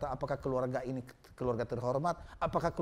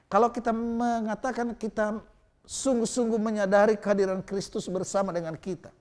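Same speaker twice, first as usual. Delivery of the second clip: preaching; 8 semitones higher, 6 dB louder than usual. Acted. Sermon emphasis.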